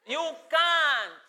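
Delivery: loud, high emphatic you can't; loud firm you can't; loud declaration. Shown as a demonstration. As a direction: declare it firmly and loudly. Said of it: "loud then soft contrast".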